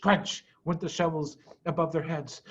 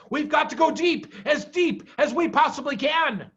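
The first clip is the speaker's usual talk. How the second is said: higher, urgent instruction; urgent command; 9 semitones higher, 4 dB louder than usual. A narrator's reading. Mr Fox urging deeper digging.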